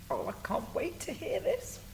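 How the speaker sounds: usual speaking voice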